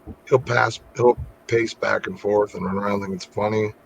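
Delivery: ordinary talk, nothing marked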